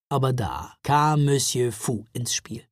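ordinary delivery, nothing marked

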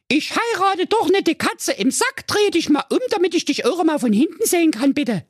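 very high, demanding princess voice; bossy Jacqueline. Acted. Jacqueline inspecting her suitor, high demanding voice. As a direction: order him to turn around, high and bossy.

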